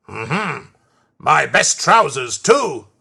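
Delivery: loud, indignant complaint after a grunt; grunt, then complain loudly; angry Fat Controller. A narrator's reading. Fat Controller finding his trousers spoilt, loud indignant scolding.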